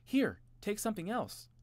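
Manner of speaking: high, eager offer; enthusiastic. A narrator's reading. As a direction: push it on him eagerly. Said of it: "added enthusiastically".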